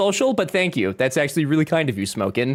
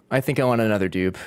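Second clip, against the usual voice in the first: lower repeat; 7 semitones lower, faster than usual. Shown as a demonstration. Streamer repeating the dupe wish, dropped much lower. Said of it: "repeats lower".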